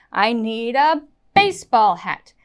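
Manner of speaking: "baseball" punched on BASE after a light "I need a"; stress on BASEBALL. Shown as a demonstration. Teacher stresses the new information, emphatic "baseball". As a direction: lean hard on "baseball". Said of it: "baseball is my most important word".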